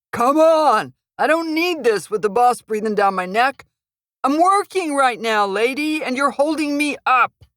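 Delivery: high, loud impatient outburst; a brute losing patience; angry thug. Acted. Thug curses at blocking woman.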